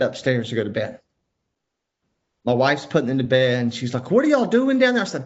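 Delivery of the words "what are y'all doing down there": high, louder mimicked call downstairs; wife calling down. Wife to husband as she puts kids to bed, calling down. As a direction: imitate a wife calling from upstairs.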